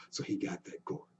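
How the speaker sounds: ordinary delivery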